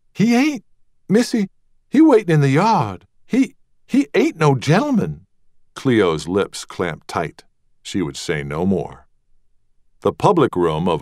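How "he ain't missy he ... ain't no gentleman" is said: high, breathless voice of a fretful maid; anxious maid. Acted. Maid rushing in to tell Rosemary, high and anxious. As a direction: flutter it out, hands wringing.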